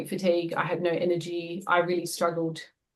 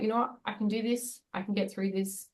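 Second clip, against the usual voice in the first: soft self-reassurance; 8 dB quieter than usual. Retold or imitated. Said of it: "I'm like".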